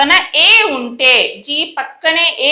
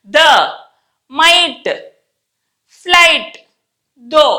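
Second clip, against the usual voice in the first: practice words with long gaps between; isolated, carefully spaced words; word-by-word reading; much slower than usual. Shown as a demonstration. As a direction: read each word alone for learners. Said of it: "నేను చదువుతాను".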